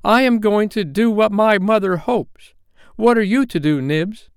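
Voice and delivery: put-on very high, loud boy's outburst; hysterical cry. Toodles rallying the boys to obey Mother, hysterical cry. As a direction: cry out hysterically in a piercing pitch. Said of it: "Toodles cried out hysterically".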